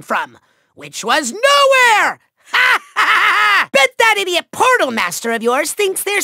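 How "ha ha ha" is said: evil cackle. Acted. Villain mocking the trapped Skylander, gloating cackle. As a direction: cackle in triumph. Villain laughs at own insult.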